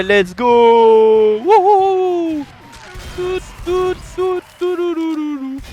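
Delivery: loud, high, triumphant yelling; rising victory shout turning into a chant; excited cheering. Real, not acted. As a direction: cheer your own clutch win at full voice. Winning clutch on the point.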